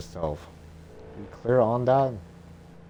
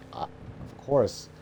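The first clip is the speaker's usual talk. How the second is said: whispered aside; 3 semitones higher, slower than usual. Acted. Mushu's embarrassed aside.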